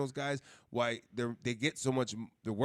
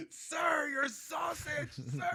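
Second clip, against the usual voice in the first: very high slow yelling vendor; mock vendor yelling about a sausage; screaming; much slower than usual. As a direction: shout it across the flea market. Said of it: "yelling through the flea market".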